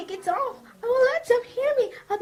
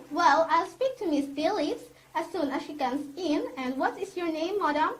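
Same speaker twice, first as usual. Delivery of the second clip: usual speaking voice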